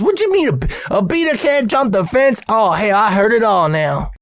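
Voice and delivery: high-pitched incredulous boss impression; imitated scoffing disbelief; Earl in disbelief. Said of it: "And he goes".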